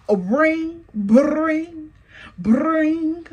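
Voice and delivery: sung-out ringing phone, ring bring bring; phone ring imitation. Mimicking the phone ringing while she calls Southwest.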